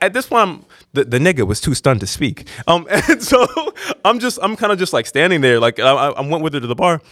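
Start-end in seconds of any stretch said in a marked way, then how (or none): none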